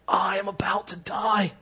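whispered, dying snake; a frail whispering serpent. Acted. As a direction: hush it like a cold, dying snake. Snake speaks in the legend.

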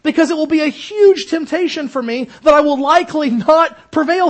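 high, loud, urgent sermon voice; fiery preacher pleading with the flock; preaching at full force. Acted. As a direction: press the warning hard on the congregation. Preacher warns of temptation.

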